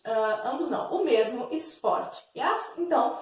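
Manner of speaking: ordinary talk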